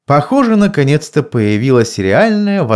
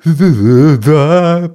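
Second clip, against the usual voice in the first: groaning; 5 semitones higher, 5 dB louder, much slower than usual. A narrator's reading. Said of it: "простонал он".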